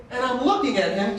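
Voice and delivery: ordinary delivery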